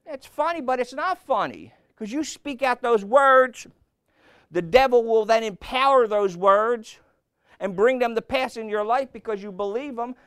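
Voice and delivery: loud, high, driving sermon voice; preaching at full force. Acted. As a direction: hammer it home from the pulpit.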